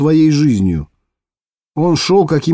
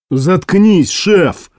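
snapped command; barking; 5 semitones higher, much slower than usual. Acted. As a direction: bark it back through the door. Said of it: "рявкнул из-за двери Клес".

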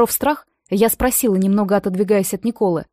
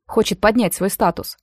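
plain reading voice

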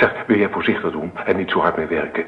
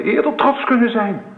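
proud outburst; 3 dB louder than usual. Acted. Husband hears of pregnancy late.

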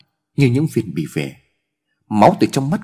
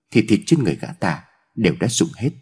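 usual speaking voice

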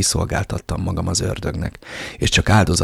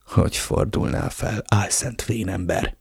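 much softer, muttered under breath; hissed aside; 5 dB quieter than usual. Acted. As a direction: curse him under your breath. Hateful aside at Ramirez. (halkan, gyűlölködve motyogva)

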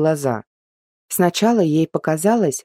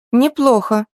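impressed; 8 semitones higher, 4 dB louder than usual. A narrator's reading. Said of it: "оценила Арина".

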